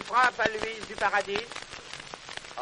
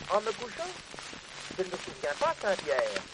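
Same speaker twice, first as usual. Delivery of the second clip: ordinary delivery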